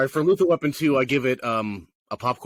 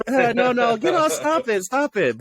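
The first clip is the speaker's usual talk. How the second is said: high mock squeal of protest, much higher; 11 semitones higher than usual. Woman fending off Riggs, mock high protest. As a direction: play the woman batting him off, high-pitched. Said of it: "But she says".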